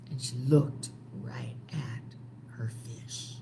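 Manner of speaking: hushed storyteller whisper; spooky whispered narration. A narrator's reading. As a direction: lean in and whisper it. Storyteller builds suspense.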